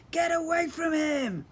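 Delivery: very high, loud, slow roar; bellowed. Acted. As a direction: bellow in troll rage. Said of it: "I bellowed".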